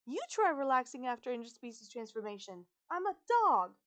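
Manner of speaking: loud, climbing high on the last words; indignant retort. A narrator's reading. Transformed girl told to relax, indignant retort.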